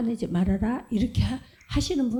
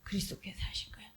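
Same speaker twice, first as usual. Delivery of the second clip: a hushed, quicker whisper; 3 semitones lower, 13 dB quieter, faster than usual. Shown as a demonstration. Preacher gives the answer to congregation, quick whisper. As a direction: let the answer fall to a whisper. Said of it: "whispered answer".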